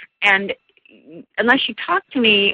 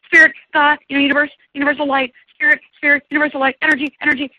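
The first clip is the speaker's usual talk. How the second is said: frantic substitute words; 10 semitones higher, 4 dB louder, faster than usual. Retold or imitated. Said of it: "I worked overtime in that session going".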